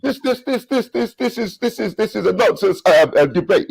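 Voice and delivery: high, stammering mock whine of a flustered opponent; mocking a stammering rival. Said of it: "guess what was happening?".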